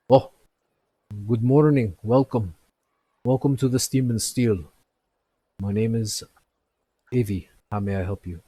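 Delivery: lightly voiced friendly smith; shopkeeper greeting. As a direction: greet customers cheerfully from behind the counter. GM voicing shopkeeper Embrick.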